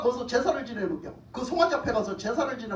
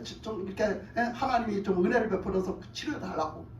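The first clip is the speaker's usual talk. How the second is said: ordinary talk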